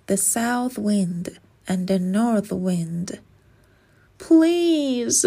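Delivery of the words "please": high, pleading please; begging. Acted. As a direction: beg the wind to help. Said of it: "Astrid begged".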